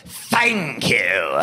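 broad mock-Cockney thanks. Singer thanks the crowd after a ballad, Cockney accent. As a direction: put on a drawn-out Cockney accent. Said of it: "that kind of British that it's like".

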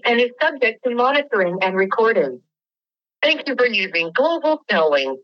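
recorded automated phone prompt; canned prison call announcement; phone menu voice. Real, not acted.

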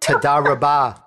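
"Toda Rabah" with a native Hebrew lilt, first word stressed; corrected Hebrew. Shown as a demonstration. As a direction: say "Toda Rabah" the way an Israeli would. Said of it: "Now I'm gonna correct your accent".

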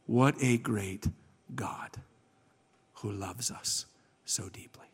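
slow, low reverent praise; hushed awe. Retold or imitated. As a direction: sink almost to a whisper, with pauses.

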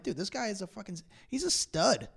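hushed fanboy admiration; admiring gush. Retold or imitated. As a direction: quote yourself marvelling at someone. Quoting own admiring reaction.

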